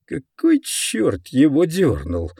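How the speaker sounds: usual speaking voice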